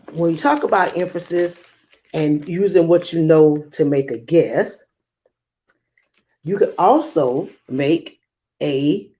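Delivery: ordinary delivery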